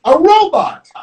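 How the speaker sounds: high-pitched excited cry; story-time scream. Acted. Storyteller voices Gerald seeing the robot, screaming in play. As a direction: cry it out high for young listeners. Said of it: "And screams".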